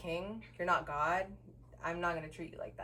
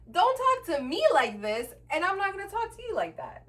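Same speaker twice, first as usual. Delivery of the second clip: high, loud, emphatic retort; retold yelling back; 10 semitones higher, 8 dB louder than usual.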